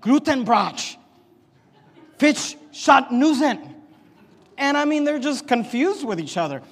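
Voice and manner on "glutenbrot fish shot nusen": slow, higher and louder fake German; mock German gibberish. Builder babbling fake German at his coworker, hammed-up. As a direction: bark out invented German words. Worker answers in made-up language.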